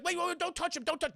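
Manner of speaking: acted-out anxious pleading; urgent warning. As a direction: warn them off in a hurry. Acting Kevin's urgent warning.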